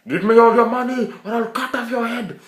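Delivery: high, loud shouted robbery; screamed threat. Retold or imitated. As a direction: yell it like a mugger. Mugger threat.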